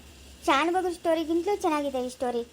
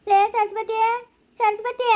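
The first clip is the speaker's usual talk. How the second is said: loud, higher call across the house; 7 semitones higher, 5 dB louder, slower than usual. Acted. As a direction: shout for Saraswati. Calling out to Saraswati. (ಸರಸ್ವತಿಯನ್ನು ಜೋರಾಗಿ ಕರೆಯುವುದು)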